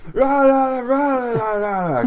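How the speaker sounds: very high, loud rah rah; imitating someone yelling back; wordless yelling. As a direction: bellow nonsense from far away. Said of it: "person down there responds".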